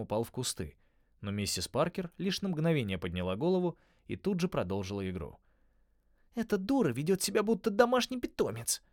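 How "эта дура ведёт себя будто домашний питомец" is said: high, scornful angry outburst; helpless fury. Acted. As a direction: spit it out in powerless rage. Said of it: "с бессильной яростью заметила Холли".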